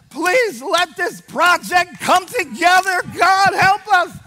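loud, high wailing plea; desperate altar prayer. Retold or imitated. Preacher re-enacts begging God at the altar, desperate cry. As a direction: cry out to God with drawn-out words. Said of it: "I just went like this".

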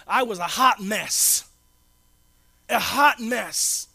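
slow, weighted hot mess; slow confession. Real, not acted. Pastor to church about his past, slow and weighted.

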